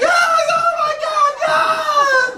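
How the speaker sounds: high shrieking yes, oh my God; excited scream. Retold or imitated. Fiance screams at proposal.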